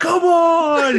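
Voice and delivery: loud, high mock outcry; playful yell. Co-host joining the car-screaming story, playful yell. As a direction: yell it high through laughter. Joins in the reenacted car yelling.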